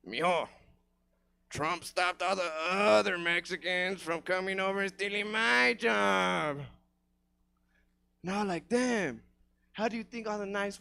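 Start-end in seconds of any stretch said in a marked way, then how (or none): 0.1-6.6 Mexican-accented dad imitation. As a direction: play the dad, drawing out the line. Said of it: "He said, (my Mexican dad)".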